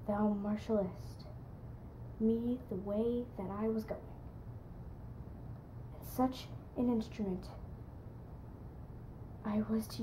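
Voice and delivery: haunted soliloquy. Acted. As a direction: speak softly to the dagger, almost whispering in places.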